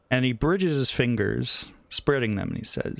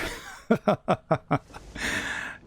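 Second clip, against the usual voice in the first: breathy chuckle; 6 semitones higher than usual. Acted. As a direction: chuckle darkly at their fate. Master laughs about vampire fate.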